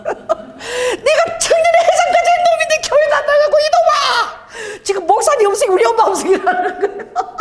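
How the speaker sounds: high, tearful voice breaking into sobs; crying retelling. Retold or imitated. Gangster tearfully quoting his scolding mother. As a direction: tell it through tears and laughter. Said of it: "우시면서".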